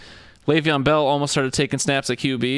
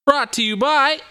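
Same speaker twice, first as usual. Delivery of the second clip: announcer voice; 12 semitones higher, 4 dB louder than usual. Acted. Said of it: "I wasn't ready".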